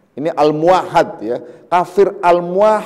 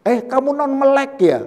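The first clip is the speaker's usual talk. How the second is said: higher, pointed mock question; accusing sneer; 8 semitones higher than usual. Teacher mimics someone needling a blind man, accusing and higher. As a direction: needle him with a loaded label.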